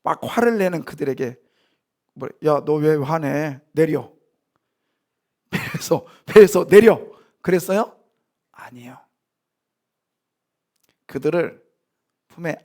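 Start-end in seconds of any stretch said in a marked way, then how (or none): 2.5-7.0 mock scolding. Imagined Jesus scolding disciples. 8.5-9.0 a breathless whispered no. Real, not acted. Pastor answers the congregation's question with a whispered no.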